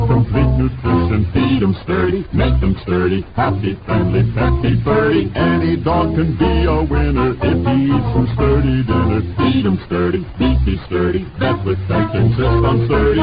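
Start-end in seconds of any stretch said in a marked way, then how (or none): none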